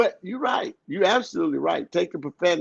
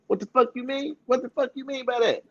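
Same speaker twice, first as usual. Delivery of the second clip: higher, softer mock snap; retold cussing retort; 6 semitones higher, 4 dB quieter than usual. Man imitating how he talks to his significant other, mock retort. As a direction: toss off "fuck" lightly, imitating himself. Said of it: "I mean, I say".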